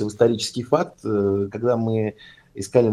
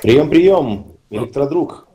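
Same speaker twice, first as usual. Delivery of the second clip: loud, higher radio call-out; 6 semitones higher, 6 dB louder, slower than usual. Acted. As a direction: hail him like a radio operator.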